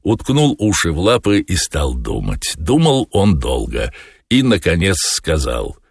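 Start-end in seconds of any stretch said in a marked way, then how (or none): none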